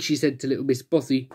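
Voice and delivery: usual speaking voice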